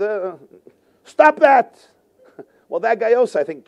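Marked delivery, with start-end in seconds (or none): 1.0-1.9 fish's command. Acted. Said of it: "imagine the fish said".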